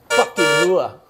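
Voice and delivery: loud hype bark; hype shout. Real, not acted. Fan hyping a sticker, loud shout. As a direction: shout it pumped up.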